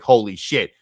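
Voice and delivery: punchy re-enactment of yelling into a phone; retold scream. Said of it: "I just started screaming on the phone".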